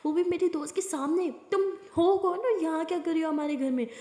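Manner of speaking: high, rising angry confrontation. Acted. Aliya confronts the strange woman in her home, angrily. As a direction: confront the intruder, furious. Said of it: "Alia को गुस्सा आ जाता है".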